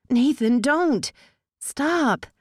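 high, urgent desperate plea. Acted. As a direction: beg him to stop. Being forced, pleading.

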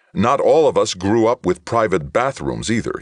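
loud, high sharp comeback; retorting. A narrator's reading. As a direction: fire it back indignantly. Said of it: "he retorted".

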